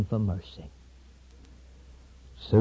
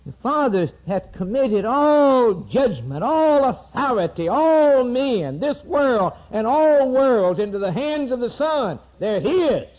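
high, loud, ringing preacher's cry; fiery preaching; 10 semitones higher, 6 dB louder than usual. Acted. Preacher proclaiming the Son's authority, fiery and loud. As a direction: preach it out to the whole congregation.

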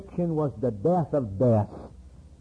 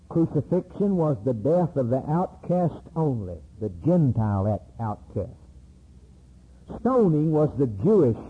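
usual speaking voice

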